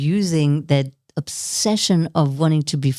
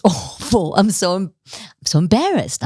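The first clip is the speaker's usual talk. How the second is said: usual speaking voice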